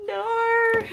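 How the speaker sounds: very high, drawn-out no; mock wail. Streamer teased about being old, high mock wail.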